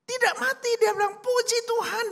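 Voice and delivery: overjoyed relief. A narrator's reading. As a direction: burst out in grateful relief. Old man relieved, overjoyed.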